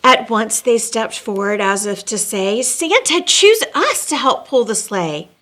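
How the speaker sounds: lilting read-aloud storybook voice; storyteller reading rhyming verse; story narration. A narrator's reading. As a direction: read it like a bedtime rhyme to kids. Reads rhyming Christmas story aloud.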